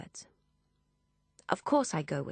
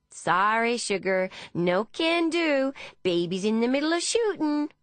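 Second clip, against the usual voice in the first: high, loud Texan woman; Texan accent; 6 semitones higher, 4 dB louder than usual. A narrator's reading. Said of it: "in an accent similar to Deborah's Texan friend".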